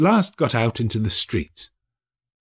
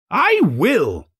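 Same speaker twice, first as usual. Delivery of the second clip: cried out; 9 semitones higher, 4 dB louder than usual. Acted. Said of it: "cried the old gentleman".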